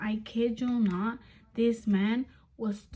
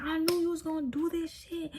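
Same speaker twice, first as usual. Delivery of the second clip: higher, whiny accusation, trailing softer; mock accusing boyfriend; 6 semitones higher, 3 dB quieter than usual. Boyfriend in the car accusing her, higher whiny mimic. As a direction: imitate him blaming her, voice pitched up. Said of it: "He's like".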